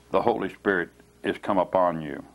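normal talking voice

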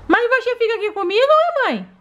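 put-on high little toddler voice; a small child talking to her mom; baby doll voice. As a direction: play the sick baby begging to play.

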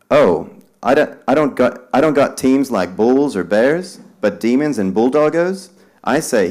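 mild character voice inside the poem; lightly voiced Chicago visitor. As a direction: lift it a little above the verse.

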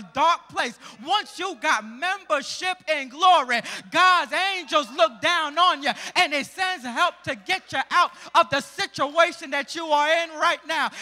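loud, climbing sermon close; preacher's rousing finish; full-force preaching. Acted. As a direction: drive it home to the congregation.

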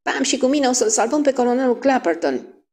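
high, eager announcement; keyed-up young girl; excited girl shouting. A narrator's reading. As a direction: declare a rescue mission, high-pitched. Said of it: "strigă Kitty Mooney".

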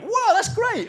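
high, delighted mock exclamation; excited whoa. Directors reacting to the pitched idea, delighted. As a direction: gush it like thrilled bosses. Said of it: "they went".

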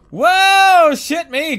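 loud, high drawn-out whoa; startled yelp. Real, not acted. Player startled by the men around him, alarmed yelp. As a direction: cry out in sudden alarm.

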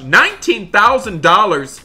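loud, high exclamation at the price; shocked disbelief. Acted. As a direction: blurt the figure out, aghast. Reacts to the computer's price.

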